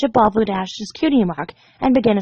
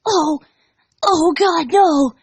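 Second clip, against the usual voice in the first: horrified gasp; 7 semitones higher, much slower than usual. Acted. Dash seeing the room of pony remains in horror. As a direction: recoil at the sight. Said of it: "reeled in horror".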